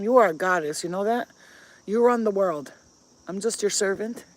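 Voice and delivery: normal talking voice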